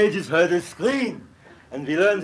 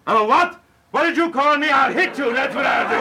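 high, loud indignant threat; outraged; 8 semitones higher, 4 dB louder than usual. Acted. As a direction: bristle and threaten. Oedipus outraged at insult.

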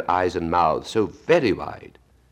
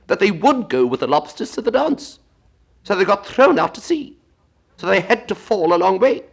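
a high, loud squawking Gryphon explaining; 7 dB louder than usual. Acted. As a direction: pitch it high and bold as the Gryphon. Gryphon speaking.

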